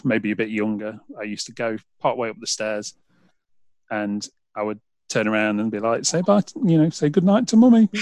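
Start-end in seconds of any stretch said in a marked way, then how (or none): 6.1-7.9 put-on higher, sing-out voice to a small child; parent's raised child-directed voice; parent coaxing toddler. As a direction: gently prompt a toddler to say goodnight, pitched up. Parent prompting toddler to say goodnight.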